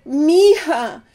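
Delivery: a light touch of the neighbor's emotion; retold tearful thanks. The neighbor calling Eva mija, a hint of tearful gratitude. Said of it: "she was sobbing and thanking me".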